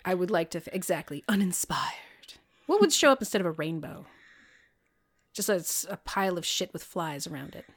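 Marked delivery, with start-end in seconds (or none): 0.0-2.3 deflated whisper. Real, not acted. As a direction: let it sag out as a whisper. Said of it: "deflated whisper".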